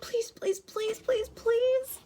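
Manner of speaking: very high, small pleading voice; anxious mutter. A narrator's reading. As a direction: repeat it as a high, desperate plea. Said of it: "he muttered".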